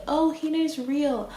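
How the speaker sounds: high, mock-credulous quote; mock naive belief. Rejecting her own supposed belief in the catfish, mock naive. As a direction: play up a gullible little thought.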